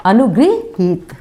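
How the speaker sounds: अनुगृहीत slow, with गृ; correct form. Shown as a demonstration. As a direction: say अनुगृहीत slowly and clearly. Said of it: "उच्चारण क्या है?".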